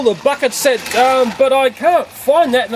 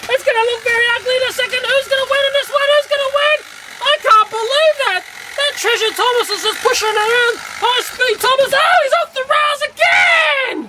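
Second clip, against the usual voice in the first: much higher, fast excited crash commentary. Real, not acted. Narrating toy train crashes, excited high commentary. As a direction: cheer on the wreck like a race caller.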